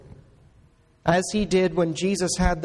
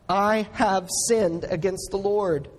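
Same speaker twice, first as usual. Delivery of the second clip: ordinary delivery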